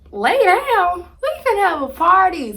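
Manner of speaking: loud giddy "lay down?"; excited protest. Retold or imitated. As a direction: pump up the party, won't let her rest. Friends refuse to let her sleep.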